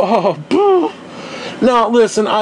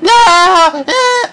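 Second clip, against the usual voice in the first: anguished high cry; 11 semitones higher, 5 dB louder than usual. Real, not acted. Anguished outcry at noise.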